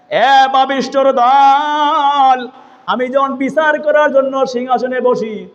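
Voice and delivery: high, drawn-out chanted scolding; angry rebuke. Acted. Omar rebukes the man he struck. (সুরে সুরে রাগী ধমক)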